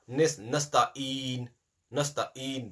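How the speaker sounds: nasta'in said slowly with the ayn left out; wrong pronunciation. Shown as a demonstration. Teacher demonstrating the dropped ayn. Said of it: "There is no ayn there now".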